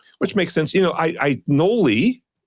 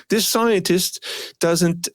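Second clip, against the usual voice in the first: higher-pitched amateur Australian impression; attempted Australian accent; 7 semitones higher, much slower than usual. Resuming the presenter impression, higher attempted Australian accent. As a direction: put on an Australian accent that could pass for British. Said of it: "that was not bad".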